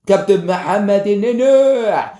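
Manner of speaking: slow, drawn-out commentator announcement; commentator call. Pretend. Mimicking a TV match commentator.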